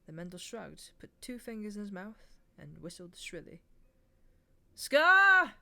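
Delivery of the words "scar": loud shouted call across the yard; calling a name out into a dark yard. A narrator's reading. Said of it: "he called".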